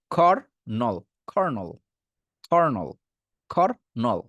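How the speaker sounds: খর and নল separately, খর্নল joined; khor, nol, khornol, each set apart; split syllables. Shown as a demonstration. Teacher drills students on two parts, split khor-nol. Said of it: "দুইটা ভাগ করে দিছি".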